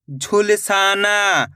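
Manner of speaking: slow, drawn-out blending of the word; slow clear reading. Shown as a demonstration. Teacher to pupils, blending the word slowly. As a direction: stretch each syllable for the pupils. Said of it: "मैं किस तरीके से एक एक अक्षर का उच्चारण".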